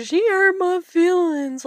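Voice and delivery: high, slow, whining voice mocking a sulky sibling; hammed-up childish complaint; mocking whiny kid. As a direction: pout it out like a tattling little sister. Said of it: "and be like".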